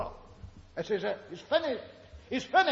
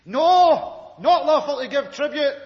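mock indignant no; 7 semitones higher, 8 dB louder than usual. Imagined answer to the trap.

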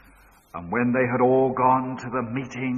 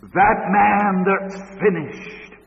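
high, loud and very slow; drawn-out pulpit cry; slow emphatic preaching; 8 semitones higher, 7 dB louder, much slower than usual. Acted. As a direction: stretch every word out with force.

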